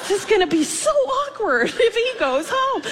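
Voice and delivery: high, laughing through the worry; nervous laugh breaking through the words. Real, not acted. Teacher fretting to God about the boy, laughing high.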